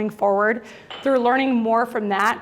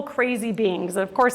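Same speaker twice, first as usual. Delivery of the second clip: ordinary talk, nothing marked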